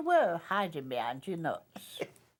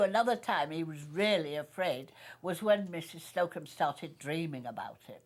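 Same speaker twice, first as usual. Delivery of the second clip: ordinary talk, nothing marked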